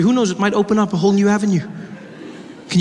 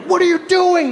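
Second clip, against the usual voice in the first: much higher mock outrage; outraged people; 13 semitones higher than usual. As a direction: squeal it in disbelief. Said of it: "They're like".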